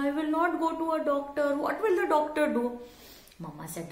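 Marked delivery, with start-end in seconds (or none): 0.1-2.6 put-on fast, higher, panicky little boy; a child's voice, quick and pleading; scared child voice. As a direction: rush it out like a frightened kid refusing. Child refusing the dentist.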